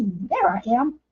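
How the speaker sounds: usual speaking voice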